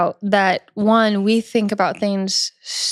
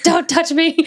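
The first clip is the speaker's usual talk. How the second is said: mock snap; 7 semitones higher, slower than usual. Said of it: "I'm just like".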